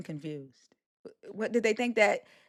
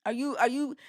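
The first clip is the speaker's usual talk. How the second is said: ordinary delivery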